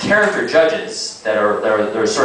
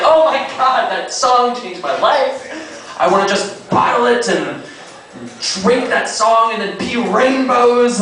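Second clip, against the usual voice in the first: high, breathless gushing judge; 9 semitones higher than usual. Retold or imitated. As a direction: gush like a weepy talent judge. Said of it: "She's just like".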